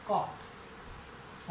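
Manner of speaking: कॉट stretched very slowly, breathed; drawn out vowel. Shown as a demonstration. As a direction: hold the vowel of कॉट long. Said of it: "long sound है वो ओ की". (कॉट में लंबी आवाज़)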